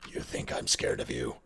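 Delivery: deep, breathy menacing trailer line; low whispered tough-guy threat; movie trailer voice. Shown as a demonstration. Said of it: "this voice".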